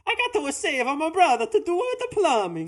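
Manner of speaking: high cartoon Italian accent; Mario voice. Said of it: "imagine if it was".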